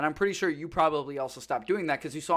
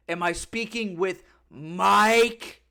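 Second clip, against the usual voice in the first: slow, drawn-out and a bit louder; slow insistent repeat; 4 dB louder, much slower than usual. Retold or imitated. Caller pressing the question again, slow and insistent. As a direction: stretch each word of "Am I speaking with Mike?".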